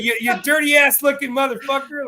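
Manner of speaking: high, loud matey greeting; mate's greeting. Retold or imitated. Greeting a best mate with a term of endearment, high and loud.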